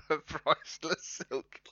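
slow, amused laughing echo; laughing repeat. Retold or imitated. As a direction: repeat it slowly while chuckling. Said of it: "laughs, repeats slowly".

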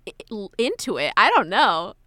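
ordinary talk

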